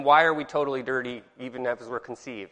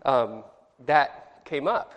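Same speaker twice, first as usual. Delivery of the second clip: ordinary talk, nothing marked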